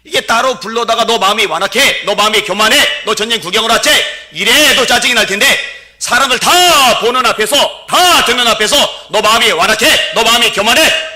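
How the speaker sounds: loud, high mocking of the brother's taunts; shouted preaching. Pastor acting the older brother shaming David, loud and high. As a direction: hurl the insults like the angry older brother.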